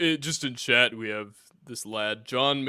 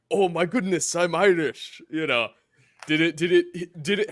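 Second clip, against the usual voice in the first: higher, louder pretend astonishment; mock sudden realization; 6 semitones higher, 6 dB louder than usual. Host imagines guest waking up one day, mock astonished. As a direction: exclaim it as a playful epiphany.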